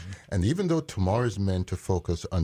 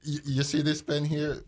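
usual speaking voice

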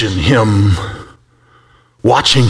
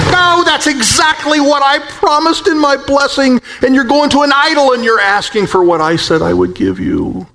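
a lightly voiced God, raised and pleading; 10 semitones higher than usual. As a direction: speak as God, hurt that they went to idols. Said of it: "And God says".

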